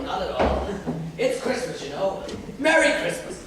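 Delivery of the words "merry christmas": a higher, louder holiday greeting; bright called-out greeting; cheery shout. Acted. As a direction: call it out merrily. Greeting carolers at the door.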